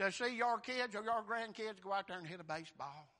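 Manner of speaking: usual speaking voice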